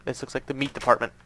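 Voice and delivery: ordinary talk, nothing marked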